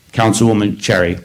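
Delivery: normal talking voice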